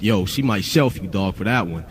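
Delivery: label guy warning. Retold or imitated. Label guy warning her, retold.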